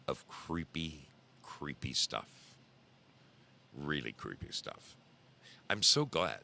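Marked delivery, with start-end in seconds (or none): 0.1-4.9 creepy teaser. Pretend. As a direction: drop low and soft to tease something creepy. Teasing tonight's creepy theme.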